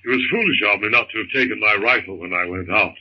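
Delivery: calm his frightened wife in a deep voice; husband's voice. Acted. John comforting wife.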